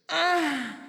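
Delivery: high-pitched mock moan; imitated can sigh. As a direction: open the can with a campy sigh. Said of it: "Sounds like this".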